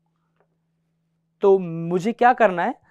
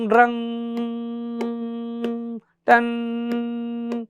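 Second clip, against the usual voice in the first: a long held "drug" then a short "टन"; teacher imitating a sustained note; sustained strum sound; much slower than usual. Shown as a demonstration. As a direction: sing the strum and let it ring. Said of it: "sustain मतलब drug".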